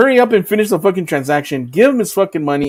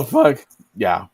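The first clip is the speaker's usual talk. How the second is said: ordinary talk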